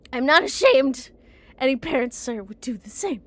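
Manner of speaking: loud indignant defence; indignant shout. Acted. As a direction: fire it back defiantly. Character defends herself loudly.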